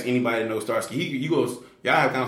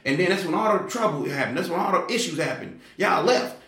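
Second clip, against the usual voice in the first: an angry woman calling out the leaders for leaving; mad lady; 5 semitones higher than usual. Retold or imitated. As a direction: scold the leaders like a furious churchgoer. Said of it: "she was mad".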